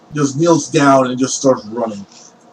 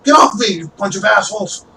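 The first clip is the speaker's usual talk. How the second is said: angry thief; 6 semitones higher than usual. Acted. Thief struggles against townsfolk.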